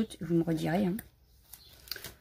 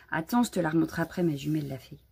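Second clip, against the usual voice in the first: ordinary talk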